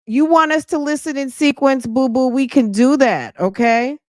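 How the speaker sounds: high, sassy mock-sweet. Real, not acted. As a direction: talk down sweetly with sass. Sassy reply to critic.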